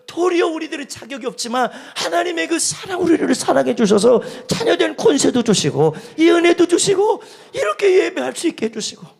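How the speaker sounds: loud, high, impassioned sermon delivery; preaching at full force. Acted. Pastor urging the congregation on God's love, loud impassioned preaching.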